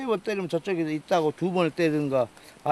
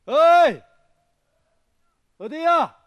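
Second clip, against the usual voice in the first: calling out across the mountain; loud yell to find the group; shouting match; 8 semitones higher, 7 dB louder, much slower than usual. Real, not acted. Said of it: "계속해서 신호를 보내는".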